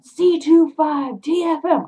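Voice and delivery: high, loud, drawn-out call; re-enacted shout at someone getting away; yell. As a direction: yell it slowly, symbol by symbol. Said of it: "and I yell".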